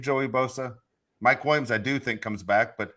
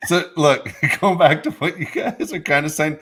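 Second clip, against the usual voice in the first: still laughing; 7 semitones higher, 7 dB louder, slower than usual. Real, not acted. Still laughing after the joke.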